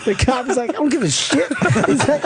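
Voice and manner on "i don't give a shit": high-pitched mock irritation; imitated exasperated officer; dismissive cop. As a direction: brush him off as an annoyed cop, pitched up. Retold cop dismissing the driver.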